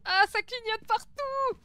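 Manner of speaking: high, loud, drawn-out alarm; startled shout at flashing lights; alarmed cry. Real, not acted. Lights flashing, ghost hunt.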